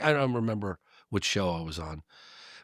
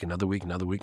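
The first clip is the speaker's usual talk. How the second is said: ordinary talk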